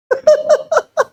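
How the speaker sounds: short high burst of laughter; bright laugh at the joke. Real, not acted. Pastor laughs at own joke.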